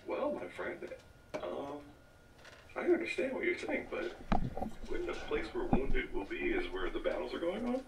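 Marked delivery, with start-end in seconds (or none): 0.1-6.5 character voice. Acted. Plays his character to the cleric.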